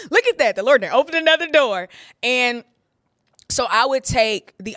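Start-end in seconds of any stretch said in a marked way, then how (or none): 0.1-1.9 higher, louder, quick crowing; mock naive delight. Newcomer hailing each opportunity as God's sign, mock delight. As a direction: marvel loudly, sending up your own naivety.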